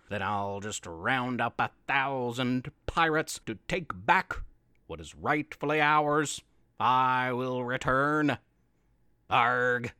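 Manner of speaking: low, growling pirate Pete; menacing pirate vow, low; gruff pirate voice. Acted.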